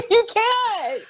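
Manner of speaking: laughing while agreeing. Real, not acted. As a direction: say it through a laugh.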